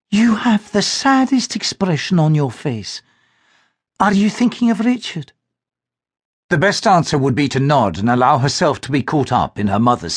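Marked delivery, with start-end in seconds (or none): 0.1-5.3 a mother coaxing out her daughter's sadness; concerned mother. Acted. Mother voiced in story.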